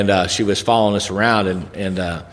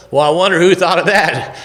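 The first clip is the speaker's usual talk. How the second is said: higher, louder lightly voiced farmer; farmer's wondering; 8 semitones higher, 5 dB louder than usual. Farmer marveling at the flying frog's trick, wondering. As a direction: wonder aloud, raised and lively. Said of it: "one of them says".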